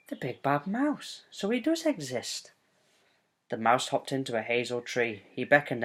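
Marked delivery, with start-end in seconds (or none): 0.1-2.5 surprised. Acted. Child figure surprised.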